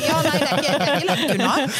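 talking through real laughter; laughing. Real, not acted. Laughs at psychologist joke. (ler mens hun snakker)

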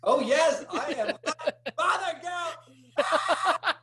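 high, laughing and fired up; laughing heated. Real, not acted. Guest laughing that the host got their blood boiling. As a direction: laugh it out while claiming to be hot. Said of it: "So I got your blood boiling".

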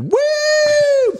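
put-on high, loud drunken whoop; drunk dad whoop. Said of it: "like a drunk dad".

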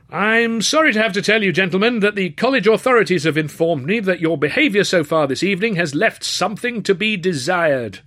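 high, loud formal announcement; stern host. Acted. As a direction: scold the crowd from the stage. Host scolds the rowdy crowd.

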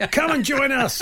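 high, called-out mock recruiting pitch; mock eager invitation. Acting out a chairman's phone call.

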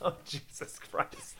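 soft, breathy groan of embarrassment; a friend embarrassed by the impression; embarrassed mutter. Real, not acted. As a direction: groan it under your breath.

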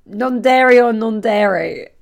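loud, slow, grand host offer; mock hostess. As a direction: announce it like a proud hostess. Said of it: "Someone comes round".

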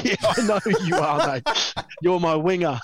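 teasing line broken by a laugh; laughing reply. Retold or imitated. Roommate amused at the nervous rookie's introduction, laughing. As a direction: chuckle through it, amused by the rookie.

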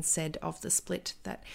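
ordinary delivery

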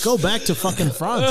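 a laughing, mock-angry Aussie accent. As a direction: tell him off like a laughing Aussie bloke. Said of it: "you're sounding very Aussie right there".

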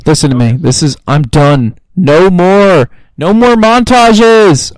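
very loud, building to a drawn-out yell; mic-destroying rant. Pretend. Host done with movie montages, mock-furious shouting into the mic. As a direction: bellow right up against the microphone. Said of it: "get really close to the mic here and just destroy this".

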